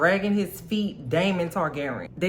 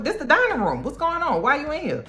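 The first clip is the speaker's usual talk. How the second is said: high, bewildered mock voice; a timid old man confused at the door; pitched-up worried host; flustered Uncle Strong; 11 semitones higher than usual. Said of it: "Uncle Strong opens the door and he's like".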